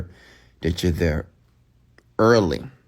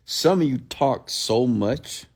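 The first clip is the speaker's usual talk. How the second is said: normal talking voice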